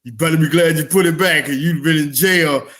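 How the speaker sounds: ordinary delivery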